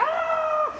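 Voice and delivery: very high squeaky scream of fright; a tiny ant shrieking at the hand; little ant screams as the giant hand comes. Acted. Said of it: "y la hormiga".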